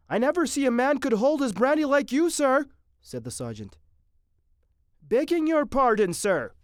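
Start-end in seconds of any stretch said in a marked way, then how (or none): 0.1-2.7 high, loud soldier voice; admiring sergeant. Acted. Sergeant admiring how Captain Mitty drinks, high and loud. 5.0-6.5 high, loud soldier voice; admiring sergeant. Acted. Sergeant admiring how Captain Mitty drinks, high and loud.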